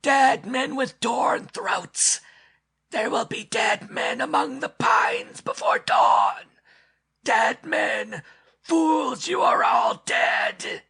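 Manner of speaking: high, slow, breathy shrieking creature; inhuman voice. A narrator's reading. Hidden creature threatens the men among the pines, eerie shriek. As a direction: hiss and shriek it like a beast with human words. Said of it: "Their inhuman intonations".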